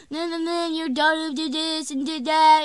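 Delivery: high-pitched mock rant; retold screaming. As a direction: imitate a neighbor yelling accusations. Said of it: "screaming at my dad".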